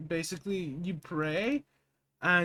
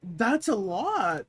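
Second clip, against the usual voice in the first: surprised; 5 semitones higher, 8 dB louder, much slower than usual. Real, not acted. Stunned reaction.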